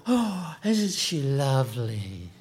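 a lightly voiced gushing guest, slow; mock a guest cooing over the bride; admiring wedding guest.

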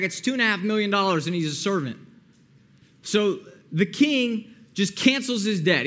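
normal talking voice

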